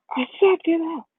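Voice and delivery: high-pitched, annoyed protest; retold boy at the end of his patience; exasperated order. Crying Deku sending away whoever calls him, exasperated.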